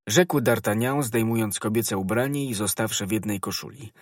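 ordinary delivery, nothing marked

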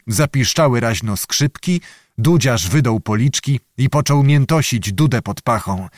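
ordinary delivery, nothing marked

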